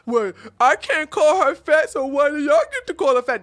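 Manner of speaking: high, loud, fast mimicked protest; imitating someone loudly protesting unfairness; mock indignant complaint.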